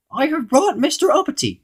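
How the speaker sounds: very high goblin announcement. A narrator's reading. Goblin presenting Mister Uppity to the sentry, high voice. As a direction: report it in a goblin squeak. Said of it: "said the goblin".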